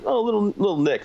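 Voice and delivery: mocking high voice, shrugging off a scratch; mock dismissive voice. Mocking a minor injury.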